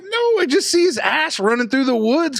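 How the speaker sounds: high, worked-up mock protest; retold exasperation. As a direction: argue back at your brother in disbelief. Retold exasperated reply to brother.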